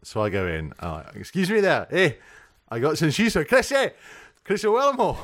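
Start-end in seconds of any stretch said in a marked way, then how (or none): 1.1-3.9 hammed-up higher Scottish accent; mock Scottish shop customer. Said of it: "I'm gonna have to do the accent". 4.4-5.2 "Owellamo" said high in a put-on Scottish accent; mock Scottish "Chris Owellamo". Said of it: "I'm gonna have to do the accent".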